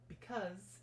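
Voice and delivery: ordinary delivery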